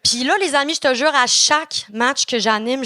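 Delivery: ordinary talk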